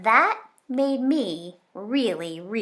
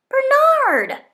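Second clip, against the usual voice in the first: scolding shout; 10 dB louder than usual. Acted. Zoe scolds Bernard at table.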